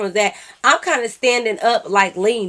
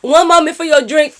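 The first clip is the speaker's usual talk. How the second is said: lightly imitated server, raised voice; high, loud customer-service call; server's announcement; 4 semitones higher, 7 dB louder than usual. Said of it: "She like".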